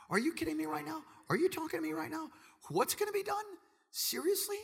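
high, fast incredulous disbelief; young David who can't believe his luck. Acted. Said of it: "It's like he can't believe it".

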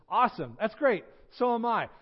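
high, loud, delighted exclamation; acted eager excitement; thrilled agreement. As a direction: cheer at finding common ground. Preacher thrilled at another match.

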